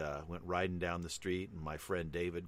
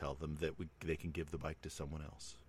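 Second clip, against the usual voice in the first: deadpan parent; 3 semitones lower, 8 dB quieter than usual. Retold or imitated. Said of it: "completely straight-faced".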